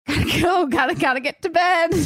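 high loud gotta-go in her thirties at eight thirty; mock hurried exit. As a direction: hurry off like it's past bedtime. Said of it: "I was like".

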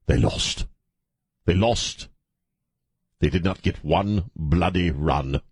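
slow, stagey put-on British accent; contrived British accent. Host mourning the lost game, contrived British accent. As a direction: draw it out in a mock-British accent. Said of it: "speaking suddenly in a contrived British accent".

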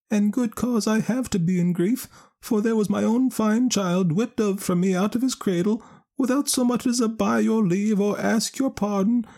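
high, distressed Irish mother; grieving mother voice. Acted. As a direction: lament her stolen child. Grieving mother Missus Sullivan speaks.

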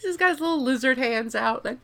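ordinary talk, nothing marked

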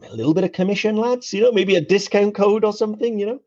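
high-pitched mock pleading; put-on hopeful begging voice; cheeky wheedling. Acting out cheeky email to brands.

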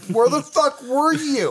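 squeaky baffled comeback; incredulous. Retold or imitated. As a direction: stretch it out in mock confusion.

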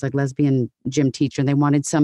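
ordinary talk

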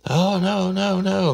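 very high falsetto mock wail; hammed-up string of squealed protests; imitating wailing suspect. Suspect reacts to the detective's accusation, mock high wailing. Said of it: "she starts screaming".

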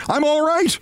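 high insistent call; insistent high reassuring yell. A narrator's reading. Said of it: "Briggs yelled to reassure her".

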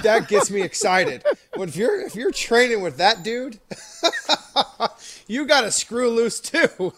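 high and loud through laughter; giggling with excitement; laughing excitedly. Real, not acted. Excited about Polamalu training.

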